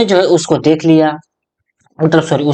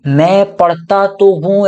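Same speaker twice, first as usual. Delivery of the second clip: "मैं पढ़ता तो हूँ" higher and slow, weight on तो हूँ; stressed तो हूँ, slow and raised; insistent stress; 5 semitones higher, slower than usual. Shown as a demonstration. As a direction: defend yourself, pressing तो हूँ. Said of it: "यानी कि जोर डाल के बोल रहे हो".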